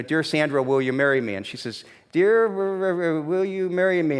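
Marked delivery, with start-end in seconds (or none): 2.1-4.2 imitating her slow, halting read of a smudged note; puzzled reading. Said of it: "she's trying to figure this out".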